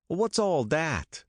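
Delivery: a high, slow challenge; demanding. A narrator's reading. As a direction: demand it like a suspicious cop. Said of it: "he demanded".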